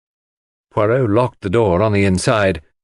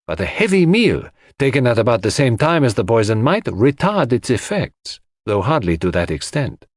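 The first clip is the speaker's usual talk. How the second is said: put-on higher-pitched, animated accented detective; Poirot, reasoning; 6 semitones higher than usual. A narrator's reading. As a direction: reason it through emphatically, point by point.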